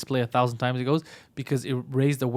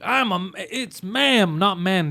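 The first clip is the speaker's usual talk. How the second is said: mock slow indignant correction; 5 semitones higher, much slower than usual. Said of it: "he was like".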